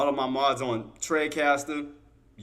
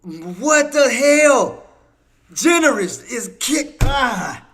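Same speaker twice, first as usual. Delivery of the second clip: loud, high, drawn-out shock; floored by a surprise gift; stunned disbelief; 9 semitones higher, 9 dB louder, much slower than usual. Real, not acted. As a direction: cry out slowly in amazement.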